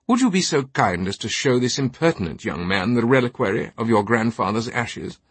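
highbrow British with indignant politeness; haughty British knight, pointedly courteous. Acted. Knight asking his companion to show impertinent lad, haughty courtesy. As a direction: make a lofty, pointed request.

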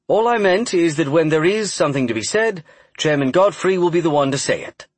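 polished, practiced press voice; proper voice. A narrator's reading. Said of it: "in his well-practiced and most proper voice".

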